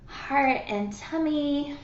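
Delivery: gentle meditation cue; guided breathing. Acted. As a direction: coax them into breathing, hushed. Leads a breathing and tapping exercise.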